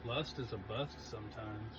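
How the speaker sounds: slow, quiet tagline read; slow mock slogan voice. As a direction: deliver it like a trademarked motto. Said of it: "T M, [name]".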